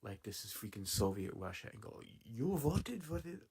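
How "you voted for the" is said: put-on a failed stab at a Russian accent, slow and higher; a half-hearted Russian accent; attempted Russian accent. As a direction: try a Russian accent and give up. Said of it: "I can't do a Russian accent".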